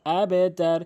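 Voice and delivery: drawn-out, chanted tajweed recitation; slow recitation. Shown as a demonstration. A teacher recites the final verse for students. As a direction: recite slowly, holding each vowel. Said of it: "তাহলে কি হলো?".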